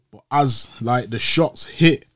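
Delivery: ordinary delivery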